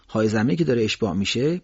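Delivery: ordinary delivery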